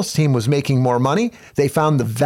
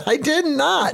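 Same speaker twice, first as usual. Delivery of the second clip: squeaky little voice; 11 semitones higher than usual. Acted. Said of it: "Squeaky Kelly".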